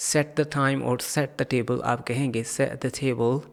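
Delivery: usual speaking voice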